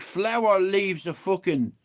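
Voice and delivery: ordinary talk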